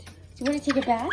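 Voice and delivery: rushed, throwaway question to the dog; tossed off fast to the dog; quick mumble. Real, not acted. Owner mumbling quickly to her dog about a bath, fast. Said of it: "repeats question louder, higher".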